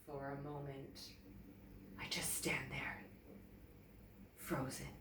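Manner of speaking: frightened, breathless whisper. A narrator's reading. Emily frozen at seeing Dad in danger, whispering.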